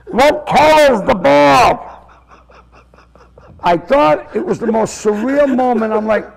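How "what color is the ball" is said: drawn-out, raised voice from out of sight; calling out. Retold or imitated. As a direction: call it slowly across the dark, imitating him. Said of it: "and I hear this".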